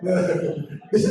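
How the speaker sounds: ordinary delivery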